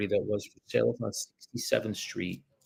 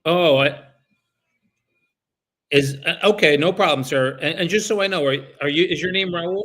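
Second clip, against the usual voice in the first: higher, louder, polite cold-call voice; phone sales voice; 6 semitones higher, 9 dB louder than usual. Real, not acted. Broker cold-calling a homeowner about his listing, upbeat phone sales voice. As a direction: brighten up and pitch yourself to the homeowner.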